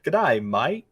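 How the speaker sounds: Australian "Good day, mate" with the vowels opened to "daɪ maɪt"; Australian accent. Shown as a demonstration. Asked on a podcast lightning round, a light Australian accent. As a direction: say "Good day, mate" like an Aussie greeting a friend. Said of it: "Say "Good day, mate" in an Australian accent".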